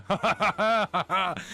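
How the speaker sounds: high cackle between teasing lines; gleeful mocking laugh. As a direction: laugh at the friend's sour face. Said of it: "[name]'s just laughing".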